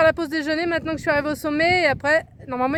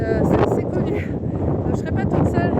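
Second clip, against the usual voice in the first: shouting over wind; 3 semitones higher, 5 dB louder than usual. Real, not acted. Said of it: "j'espère que vous m'entendez avec le vent".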